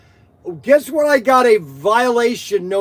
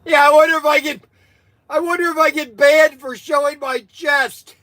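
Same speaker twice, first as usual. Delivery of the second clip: laugh-strained voice; 6 semitones higher, 3 dB louder than usual. Real, not acted. Still worked up after laughing fit.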